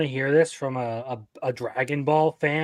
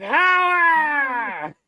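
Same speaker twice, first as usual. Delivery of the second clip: high, drawn-out theatrical cry; hammed-up high villain wail; mock villain cry; 11 semitones higher than usual. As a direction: parody a power-mad villain, stretching the word. Hams up the quoted catchphrase.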